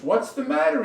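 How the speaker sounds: high, loud startled query; alarmed question. Acted. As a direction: ask it startled, pitch shooting up. Woken doctor answers alarmed Igor.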